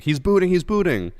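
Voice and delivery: high, loud alarmed call; loud excited quote. Retold or imitated. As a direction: shout it like a warning. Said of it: "Game Night's like".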